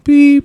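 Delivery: high, loud beep; imitated censor beep. Host pretends to bleep out his own remark, imitated beep. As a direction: mimic an edit bleep.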